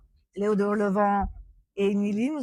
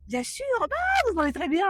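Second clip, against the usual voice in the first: much higher, sugary fake compliment; fake polite Italian; 9 semitones higher than usual. Italian pretending to compliment a French speaker, much higher fake politeness. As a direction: flatter the tourist with an overly sweet lilt. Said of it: "tu diras".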